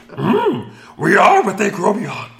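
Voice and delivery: Yoda. Retold or imitated. Reading a listener review, he slips into Yoda. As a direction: lift into a wise old puppet Yoda. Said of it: "As Yoda once said".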